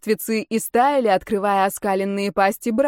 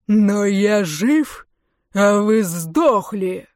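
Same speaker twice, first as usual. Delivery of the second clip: very slow, booming retort; thundering defiance; 4 dB louder, much slower than usual. A narrator's reading. Вайторис answering the jeering warriors, thundering. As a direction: thunder it, dragging out the words. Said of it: "прогремел в ответ голос Вайториса".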